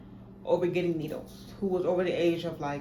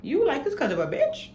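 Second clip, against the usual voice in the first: mocking doctor; 8 semitones higher than usual. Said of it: "they're like".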